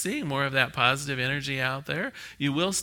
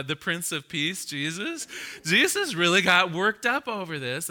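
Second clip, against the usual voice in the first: normal talking voice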